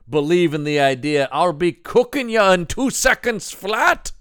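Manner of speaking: lightly voiced Irish villain; leprechaun. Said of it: "the guy responds".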